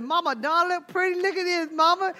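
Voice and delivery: put-on high-pitched little-girl voice; mock-cute small daughter; imitated little girl. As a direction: show off to Mama in a child's high voice. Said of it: "your little girl".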